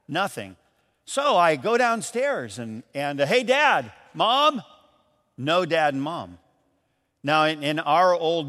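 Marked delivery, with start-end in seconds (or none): 3.3-4.6 calling out. Retold or imitated. Kid calling for missing parents.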